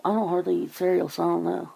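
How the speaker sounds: usual speaking voice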